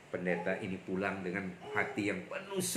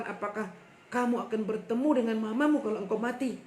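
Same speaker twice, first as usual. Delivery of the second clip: puzzled pastor; 11 semitones higher than usual. Retold or imitated. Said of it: "dia heran".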